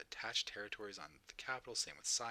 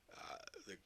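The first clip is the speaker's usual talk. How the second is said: yawning; slower than usual. Real, not acted. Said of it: "Sorry, I'm yawning now".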